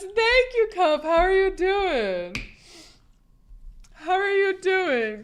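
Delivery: high, drawn-out greeting; effusive thanks. Real, not acted. Streamer greeting a supporter after congrats, effusive. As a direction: sing out the thanks, slow and gushing. Gushing thanks to another subscriber.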